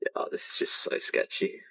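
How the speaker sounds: unvoiced nervous whisper. Real, not acted. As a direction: whisper it so no one hears. Sneaking at night, nervous.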